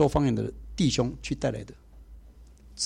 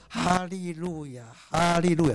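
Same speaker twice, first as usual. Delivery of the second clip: lightly imitated old sister, dragging out each hallelujah; slow hallelujah prayer; 2 semitones higher, slower than usual. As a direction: mimic an elderly sister's slow prayer, drawn out. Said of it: "慢慢的念".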